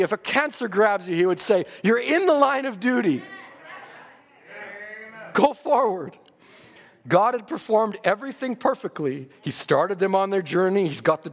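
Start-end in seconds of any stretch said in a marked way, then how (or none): 1.8-6.2 higher, firm push forward; mild exhortation. Retold or imitated. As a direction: urge them on through illness. Quoted exhortation to the sick.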